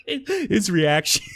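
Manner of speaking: real laughter breaking through the words; can barely get it out for laughing. Real, not acted. Laughing as he remembers the friend's reaction.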